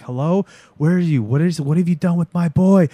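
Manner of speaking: desperate mother calling down the line, retold; mild imitated panic; freaking out. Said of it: "she's freaking out".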